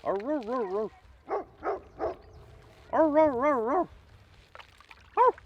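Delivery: very high, loud teasing woofs; playful imitation barking; mock dog bark.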